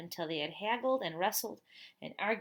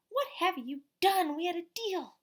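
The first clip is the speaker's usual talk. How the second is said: much higher, louder shocked cry; upset mother; 10 semitones higher, 7 dB louder than usual. A narrator's reading. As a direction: shriek at the mess. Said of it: "she screamed".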